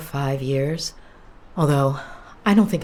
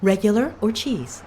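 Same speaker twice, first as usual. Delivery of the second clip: fast food cashier; 6 semitones higher than usual. Acted. Waitress taking an order.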